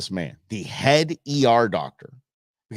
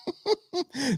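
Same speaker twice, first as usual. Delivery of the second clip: high laugh; 4 dB quieter than usual. Real, not acted. Laughs at own joke.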